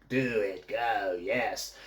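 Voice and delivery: low, soft, sinister overlord voice; Emperor from Star Wars. Retold or imitated. Witch urging on the choking, low sinister overlord impression. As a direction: quietly egg them on like an evil master. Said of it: "I've got best of Emperor from Star Wars".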